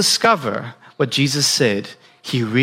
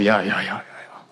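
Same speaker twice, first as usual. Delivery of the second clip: lower, softer, brushing-off yeahs that fade out; dismissive yeahs; 7 semitones lower, 6 dB quieter than usual. Retold or imitated. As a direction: wave it away lazily. Disciples brushing it off.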